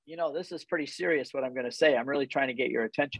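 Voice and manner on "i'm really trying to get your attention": ordinary delivery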